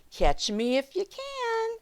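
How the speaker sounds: high, slow taunting cry; shouting gingerbread baby. Acted. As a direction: call it up high like a tiny runaway. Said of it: "the gingerbread baby shouting".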